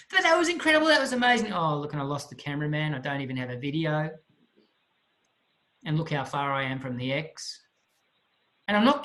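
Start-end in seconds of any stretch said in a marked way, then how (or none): 0.1-1.5 high burst of excitement; gushing praise. Retold or imitated. Divers reassuring the downcast student, excitedly gushing. 1.5-7.6 low, sinking, gloomy complaint; depressed. Retold or imitated. Student after landing without a video, gloomily dejected. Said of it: "in a state of depression that afternoon".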